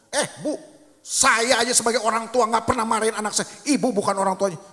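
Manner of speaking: loud, high, indignant mom telling off the teacher; pushy mother confronting teacher. Retold or imitated. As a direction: snap at the teacher like an overbearing mother. Ibu melabrak guru di sekolah. (ibu sok jago melabrak guru, suara tinggi dan keras)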